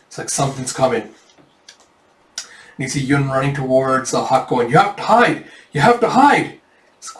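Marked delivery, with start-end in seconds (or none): 4.7-6.6 higher, louder urgent call to hide; urgent warning. Acted. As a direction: warn them urgently, rising in pitch. Yun warns them to hide.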